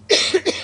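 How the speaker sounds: sharp dry cough breaking the sentence; real cough. Real, not acted. Coach interrupted by his throat mid-list, coughing. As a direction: cough hard, throat tickling. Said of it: "I'm just getting too choked up here".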